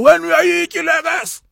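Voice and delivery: high, loud impression of an old-school uncle; rural uncle. Retelling an uncle's answer to the condom question, loud imitated uncle. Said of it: "this is an uncle of mine from".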